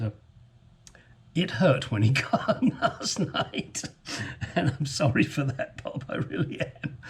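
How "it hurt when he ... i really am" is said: laughing. Real, not acted. Laughing at buddy's pain.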